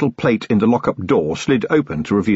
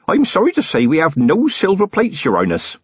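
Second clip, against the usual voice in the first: nervous mother; 10 semitones higher than usual. A narrator's reading. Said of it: "nervous giggle".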